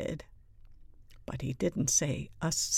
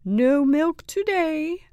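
high, loud, slow announcement; drawn-out, projected teacher's call; announcing; 10 semitones higher, 8 dB louder, slower than usual. A narrator's reading. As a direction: announce it to the whole class. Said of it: "announced Missus Wimpy Dimple".

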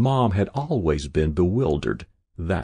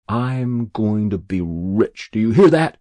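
fierce vow; 2 semitones higher, 5 dB louder than usual. A narrator's reading. Boy chopping wood vows to be rich, fiercely. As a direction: hurl it at the log like a vow. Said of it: "chopping fiercely at an obdurate log".

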